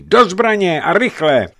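high, loud call to arms; urgent shout. A narrator's reading. Crews rouse each other to fight, shouting urgently. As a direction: yell the order across the ships. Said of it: "ozývaly se výkřiky".

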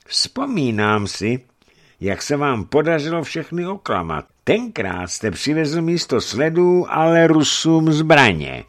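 deliberate, threatening reminder; veiled threat. A narrator's reading. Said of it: "ve slovech vyslancových ozvala hrozba".

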